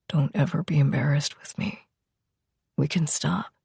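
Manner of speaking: calm her gently; tender whisper. Acted. Lover reassuring her softly.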